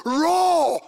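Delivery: loud, high, drawn-out shout imitating her order. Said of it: "she even said it louder".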